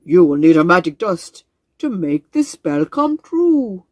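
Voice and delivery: put-on a proud old frog greeting his visitors; grand wise frog. Frog reads the spell.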